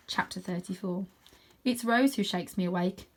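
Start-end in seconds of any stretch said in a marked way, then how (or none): none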